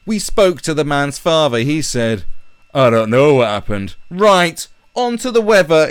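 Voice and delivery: mocking a hollow TV news bulletin; mock newsreader. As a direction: parody a TV anchor. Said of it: "It's just going".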